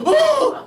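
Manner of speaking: high, loud "whoa"; startled whoop. Retold or imitated. Man losing his mole on a water slide, startled whoop. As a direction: yelp of sudden alarm.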